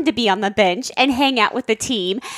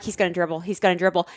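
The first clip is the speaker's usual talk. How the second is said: low, softer aside to oneself; murmured self-reassurance; 7 semitones lower, 7 dB quieter, much faster than usual. Retold or imitated. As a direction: murmur it under your breath. Anxious self-talk on the sidelines.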